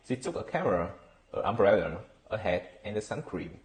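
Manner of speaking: each item rises until "sun cream" falls; rising list intonation, final fall. Shown as a demonstration. Teacher demonstrating list intonation to learners, rising items then final fall. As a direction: lift "camera", "umbrella" and "hat", drop on "sun cream". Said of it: "have a rising tone, except the last one".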